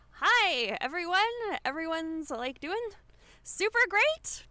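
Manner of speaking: high, loud, over-bright greeting; character greeting. Acted. Jet greeting old friends at a party, high and gushing. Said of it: "and she's like".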